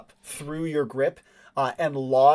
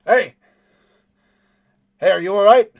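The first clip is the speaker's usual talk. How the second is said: loud call; 6 semitones higher, 12 dB louder than usual. Acted. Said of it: "he says".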